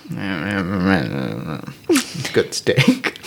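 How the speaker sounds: low, drawn-out grumble with a hidden smile; grudging words through a half-formed smile; mock-grudging scowl. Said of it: "I'm, like, scowling, but I'm that kind of scowling where you see the smile".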